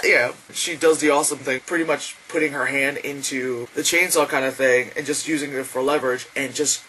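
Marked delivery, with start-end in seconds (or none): none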